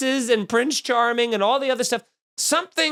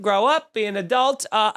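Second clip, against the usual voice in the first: normal talking voice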